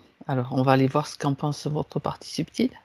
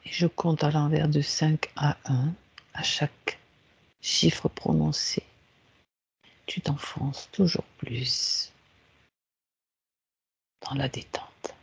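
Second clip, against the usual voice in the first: guided meditation; 3 semitones lower, 7 dB quieter than usual. Acted. Leads a guided relaxation. (voix douce de méditation guidée)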